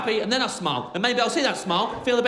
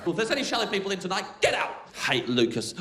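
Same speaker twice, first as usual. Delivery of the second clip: ordinary delivery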